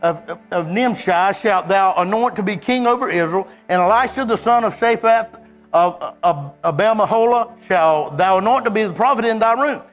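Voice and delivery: scripture read in preaching voice. Acted. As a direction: proclaim the verses without letting up.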